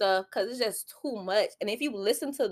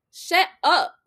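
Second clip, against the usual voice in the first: raised, much louder and higher, telling them to shut up; loud scolding shut up; 6 semitones higher, 6 dB louder than usual. Real, not acted.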